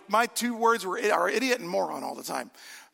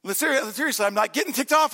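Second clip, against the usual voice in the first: normal talking voice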